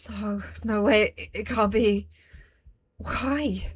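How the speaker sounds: normal talking voice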